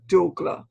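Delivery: "Dougla" said clearly as DOO-gla, stress on the first syllable; "Dougla", first syllable stressed, careful demo; careful pronunciation. Shown as a demonstration. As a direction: say "Dougla" slowly and distinctly for listeners, falling at the end. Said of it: "pronounce for me again and for our listeners".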